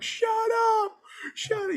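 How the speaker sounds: high mock crowd yell; crowd heckling. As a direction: jeer like a rowdy crowd. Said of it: "the whole crowd would be like".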